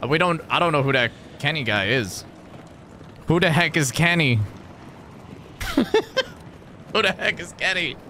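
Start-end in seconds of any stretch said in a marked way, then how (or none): 5.6-8.0 laughing. Real, not acted. Repeats it laughing.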